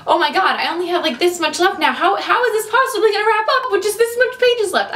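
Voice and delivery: high, panicky squeal over the last pages; frantic disbelief. Retold or imitated. Reader up at 3 AM panicking over the pages left, high and frantic. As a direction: sound frantic that the book is nearly done. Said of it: "and I'm like".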